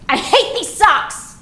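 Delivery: acted protest. Child refusing socks during the morning routine, acted protest. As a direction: complain like a cross child. Child's complaint voiced in story.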